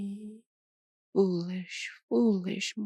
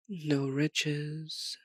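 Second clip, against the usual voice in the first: usual speaking voice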